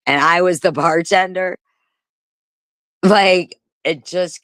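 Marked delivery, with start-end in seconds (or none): none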